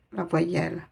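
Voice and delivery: usual speaking voice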